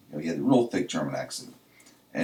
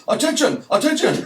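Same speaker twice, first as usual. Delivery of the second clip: loud, high comic shout of Attention!; mock German holler, Attention! Attention!; 8 dB louder than usual. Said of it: "I just hollered".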